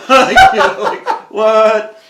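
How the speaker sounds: high and loud, breaking into laughter; laughing. Retold or imitated. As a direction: still cracking up with friends. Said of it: "busted up laughing".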